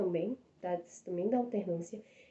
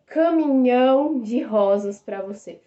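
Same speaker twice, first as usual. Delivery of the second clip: "caminhão de rosas pra você" said slow and louder, like a grand declaration; romantic example line; 2 semitones higher, 7 dB louder, slower than usual. Shown as a demonstration. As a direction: lean on "caminhão", savouring the offer. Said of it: "Você não vai dar um caminhão de rosas".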